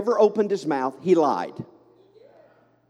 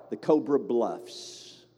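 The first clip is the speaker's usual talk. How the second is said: slow, soft Southern accent; 7 semitones lower, 5 dB quieter, slower than usual. Real, not acted. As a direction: say it slowly with a Southern twang. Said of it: "I say that with a southern accent".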